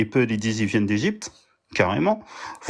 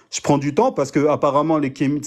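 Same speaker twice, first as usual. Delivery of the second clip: ordinary delivery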